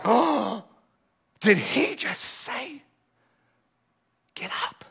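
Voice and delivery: slow, softening into an awed whisper; awed disbelief. Retold or imitated. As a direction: let it fade to a stunned whisper. Astonished crowd quote.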